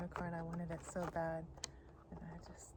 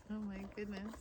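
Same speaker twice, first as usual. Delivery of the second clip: normal talking voice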